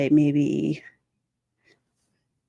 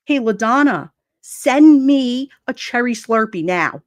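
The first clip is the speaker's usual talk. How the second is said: slow, loud, bossy mock order; aggressive demand; 3 semitones higher, 5 dB louder, slower than usual. Streamer mocks how someone orders drinks, aggressive demand. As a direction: hammer out a mock command. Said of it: "Is that how you have to talk to people?".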